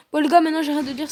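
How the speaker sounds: ordinary talk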